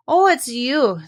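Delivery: slow, loud cross greeting. A narrator's reading. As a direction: grumble it out, annoyed. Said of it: "said Owl crossly".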